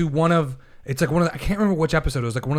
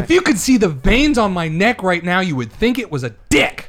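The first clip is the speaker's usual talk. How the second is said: loud, higher, worked-up comeback; heated outburst; 4 semitones higher, 6 dB louder than usual. Real, not acted. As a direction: keep the rant hot and hit the last word hard. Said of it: "your veins are gonna pop out".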